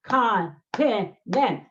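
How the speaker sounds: Continent split slowly into con-ti-nent; syllable by syllable. Shown as a demonstration. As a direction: tap out Continent one syllable at a time. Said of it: "Three syllables".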